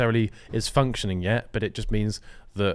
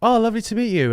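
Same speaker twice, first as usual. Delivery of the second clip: fake polite greeting; 11 semitones higher, 5 dB louder than usual. Mock polite influencer greeting.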